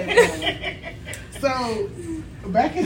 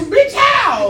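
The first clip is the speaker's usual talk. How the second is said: mock outburst; 7 semitones higher, 6 dB louder than usual.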